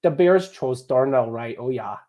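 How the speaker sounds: hammed-up northern Midwest accent; too-Midwestern Chicago accent attempt. Shown as a demonstration. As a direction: overdo a North Dakota accent. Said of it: "how is my Midwest accent".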